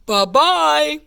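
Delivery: lightly gruff papa bear calling out; loud, drawn bear farewell; big gruff voice. A narrator's reading. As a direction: call after her as a big bear. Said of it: "a big gruff voice".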